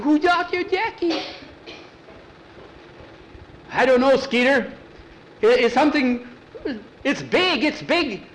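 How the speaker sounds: high, loud scared voices; frightened boys. Acted. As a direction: kids panicking in the dark.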